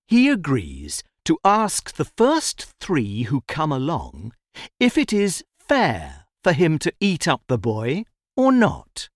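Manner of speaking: ordinary delivery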